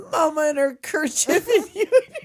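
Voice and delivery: laughing while reciting. Acted. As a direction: giggle through the rhyme. Laughing through poem.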